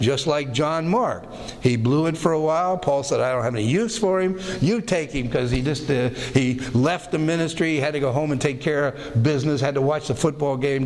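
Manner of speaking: normal talking voice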